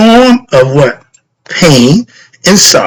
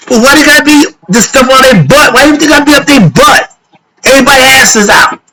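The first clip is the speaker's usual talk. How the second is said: much higher, indignant complaining; exasperated rant; 10 semitones higher, faster than usual. Real, not acted. Preacher griping to the crowd about uniforms, exasperated and high. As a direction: throw up your hands at the uniforms.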